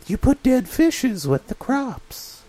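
high fish voice, slow; silly fish sharing farm tips; talking salmon voice. Acted. Back in salmon voice.

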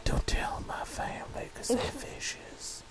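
high unvoiced whisper, slow; whispering salmon voice. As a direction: fish confiding a secret in a whisper.

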